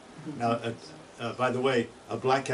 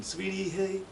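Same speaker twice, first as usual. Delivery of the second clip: monotone chickadee call; 8 semitones higher, 4 dB quieter than usual. Shown as a demonstration. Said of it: "more of a monotone".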